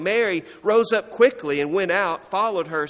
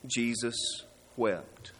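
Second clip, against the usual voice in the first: low, soft reading with a weighty pause; grave and slow-paced, sinking at the end; solemn, hushed; 7 semitones lower, 9 dB quieter than usual. Acted. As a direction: let each word fall quietly, sorrowfully. Solemn reading of the famous verse.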